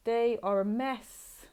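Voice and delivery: slow and loud, every word weighed; emphatic restatement. Shown as a demonstration. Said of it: "loud slow restatement".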